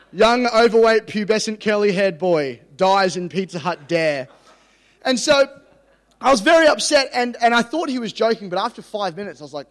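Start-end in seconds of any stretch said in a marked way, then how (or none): 0.1-4.3 mock news headline. Preacher imagining the headline of his own death, mock news announcement. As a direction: belt out a comic tragic headline.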